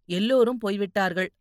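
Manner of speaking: ordinary delivery, nothing marked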